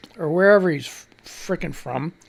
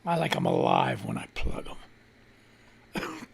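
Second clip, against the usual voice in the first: very low, slow, creepy croak; creepy killer voice; 8 dB quieter, slower than usual. Pretend. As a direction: play a sick creep, dropped deep and drawn out. Creepy killer voice, then "sick fuck.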